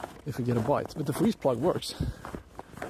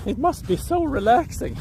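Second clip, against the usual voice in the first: mocking voice; 10 semitones higher, 3 dB louder than usual. Mock bystander voice, then rebuttal.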